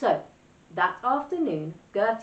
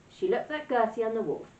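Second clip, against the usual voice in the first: ordinary delivery, nothing marked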